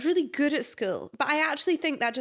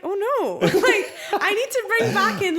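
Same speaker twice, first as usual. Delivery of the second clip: laughing dismay; 9 semitones higher, 7 dB louder than usual. Retold or imitated. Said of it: "And I'm like".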